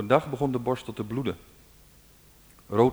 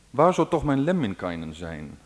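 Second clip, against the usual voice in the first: a lightly voiced worried mother, a little louder; musing mother; 5 dB louder than usual. A narrator's reading. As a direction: wonder aloud as the anxious mother. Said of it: "overpeinsde zijn moeder thuis".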